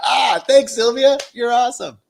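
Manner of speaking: high and loud, delighted; loud happy thanks. Real, not acted. Host thanking Sylvia for her text, loud delighted thanks. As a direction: call out the thanks, beaming.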